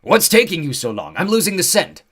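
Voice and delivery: high loud frantic creature; urgent and rushing; frantic centaur. Acted. Centaur urges Nico on.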